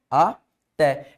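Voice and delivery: "Até" split as A, pause, té; "Até" said syllable by syllable with a clear gap; separated syllables. Shown as a demonstration. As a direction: break "Até" in two for the student. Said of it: "eu separo assim".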